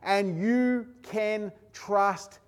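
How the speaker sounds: high, loud preaching climax; preaching at full force. Acted. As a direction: drive it home to the congregation.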